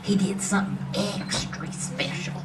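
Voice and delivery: hushed conspiratorial whisper; mock secret whisper. Old lady confiding to the audience in a whisper. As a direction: lean in and whisper it like a juicy secret.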